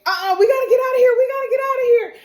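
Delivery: high, loud, rushed panicky cry; acted panic. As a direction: blurt it out frantically to flee. Acting out a character panicking in earthquake.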